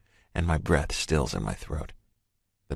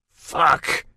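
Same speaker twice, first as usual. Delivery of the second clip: sharp loud furious shout; 11 semitones higher, 5 dB louder than usual. A narrator's reading. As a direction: spit out one angry shout. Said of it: "I shout".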